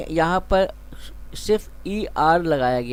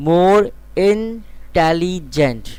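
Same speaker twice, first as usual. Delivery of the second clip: more intelligent drawn out very slowly; slow stressed example; 4 dB louder, much slower than usual. Shown as a demonstration. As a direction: stretch out more intelligent for the class. Teacher stresses example phrase.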